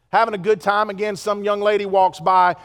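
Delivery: ordinary talk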